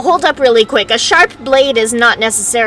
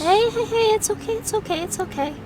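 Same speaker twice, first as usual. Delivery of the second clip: cartoon princess; 12 semitones higher, 8 dB quieter than usual. Calming panicking princess.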